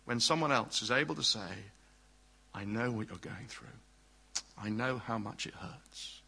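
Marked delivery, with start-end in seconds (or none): 2.5-6.2 soft, low, consoling words; hushed, tender reassurance; gentle empathy. Acted. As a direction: speak softly and low, as one who has suffered too. Voicing a comforting fellow sufferer.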